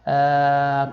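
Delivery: ordinary talk, nothing marked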